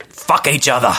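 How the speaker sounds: usual speaking voice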